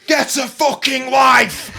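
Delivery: loud, high, drawn-out shout; angry driver's yell. Retold or imitated. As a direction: imitate abuse hurled from a passing car. Acts passing driver yelling abuse.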